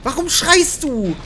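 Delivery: startled. Real, not acted. Player startled by a scream in game. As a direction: jump in fright.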